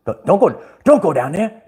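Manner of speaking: ordinary talk, nothing marked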